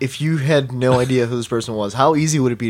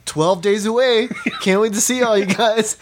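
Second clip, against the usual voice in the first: mock cheerful post; 8 semitones higher, slower than usual. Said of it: "you posted one that was like".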